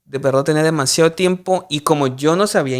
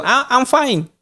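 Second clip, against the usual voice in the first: cheerful clueless reply; 9 semitones higher, much slower than usual. Retold or imitated. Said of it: "Y yo".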